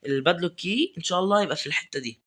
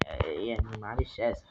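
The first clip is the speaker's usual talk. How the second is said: whisper; 4 semitones lower, 7 dB quieter, slower than usual. Real, not acted. Apologizing to viewers, unvoiced.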